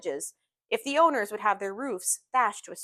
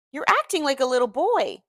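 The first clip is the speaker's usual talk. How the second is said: higher, louder impatient scolding; 4 semitones higher, 5 dB louder than usual. A narrator's reading. Friend telling Gatsby off, impatiently. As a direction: break out at him, fed up. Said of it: "I broke out impatiently".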